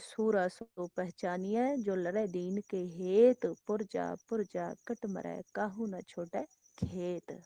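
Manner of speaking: slow, soft recitation of a verse; soft, slow reverent intoning; scripture recitation. Acted. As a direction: intone the verse slowly and softly, like holy words.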